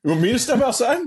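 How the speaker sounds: you want ME outside?; squeaky retold shock; mock disbelief. Challenged to fight, mock disbelief.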